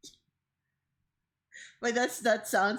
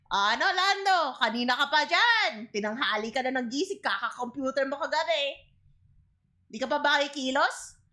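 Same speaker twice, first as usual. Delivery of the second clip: Filipino mom voice; 5 semitones higher than usual. Acted. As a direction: scold a lazy son like mom. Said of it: "Just like that typical Filipino mom".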